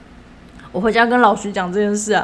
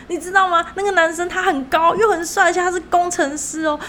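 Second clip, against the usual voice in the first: higher, fast-talking nervous coworker pushing a date; 紧张的同事; 8 semitones higher than usual. Retold or imitated. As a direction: gush about the engineer to change the subject, voice pitched up. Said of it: "然后我同事又很紧张". (模仿同事紧张地推销男生，音调偏高)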